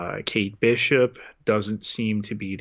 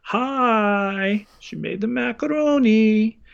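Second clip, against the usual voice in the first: much higher bright greeting voice; chirpy Yelena; 11 semitones higher, 5 dB louder than usual. Retold or imitated. Co-host voicing Yelena greeting Kate, high and chirpy. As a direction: bounce in cheerfully with a fresh pot. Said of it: "catching the sriracha to the".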